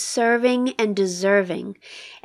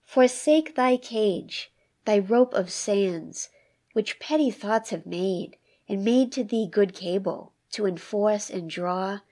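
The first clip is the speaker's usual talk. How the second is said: poem reading; 5 dB quieter than usual. Acted. Host reads the poem aloud again for the listener, measured recital. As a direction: read each line as if weighing it.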